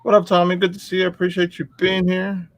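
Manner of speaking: high goofy cartoon voice; lobster voice. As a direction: greet them in a squeaky silly voice. Greeting chat in put-on voice.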